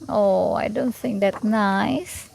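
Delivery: usual speaking voice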